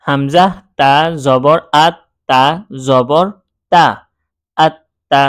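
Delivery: measured recitation with doubled t in আত্তা; paused spelling drill building up to আত্তা. Shown as a demonstration. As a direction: loudly spell হামজা, তা, জবর, then join as আত্তা. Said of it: "বানান করে পড়লাম".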